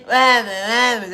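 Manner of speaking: loud, high, in-your-face demanding; drunk woman's pushy questioning. Retold or imitated. Drunk woman at liquor store pestering them, loud and high. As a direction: bark it right in someone's face like a drunk stranger. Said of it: "That's how she's talking".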